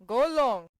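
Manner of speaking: brushing off. A narrator's reading. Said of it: "said Vronsky".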